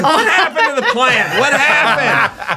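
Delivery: high laughing frustration; exasperated laughing. Retold or imitated. Complaining the partner dropped the intro plan, laughing exasperation. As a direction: vent at the failed plan.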